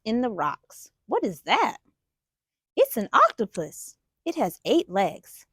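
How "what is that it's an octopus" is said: plain reading voice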